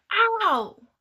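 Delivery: high, loud cry of pain; pained yelp. A narrator's reading. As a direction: yelp as you hit the ground. Thug falls hard.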